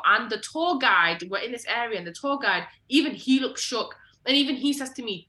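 ordinary talk

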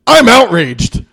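hammed-up mock outrage. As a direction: parody an online complainer. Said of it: "people are always like".

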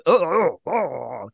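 loud strained grunts through a throat tube; imitating frustrated grunts; mock grunting. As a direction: grunt like you can't speak. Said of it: "I was grunting".

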